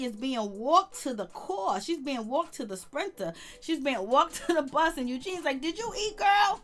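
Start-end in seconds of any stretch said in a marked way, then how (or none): 5.6-6.6 mock high coddling. Eugene quoted, mocking.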